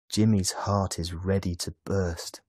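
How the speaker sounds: usual speaking voice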